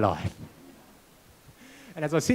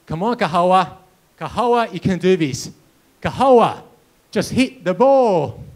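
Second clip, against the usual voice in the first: louder, pleading coach's call; exasperated coach imitation, raised voice; acted coach urging; 5 dB louder, slower than usual. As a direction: urge on a hopeless pupil, drawing out the last words. Coach urging pupil on, retold.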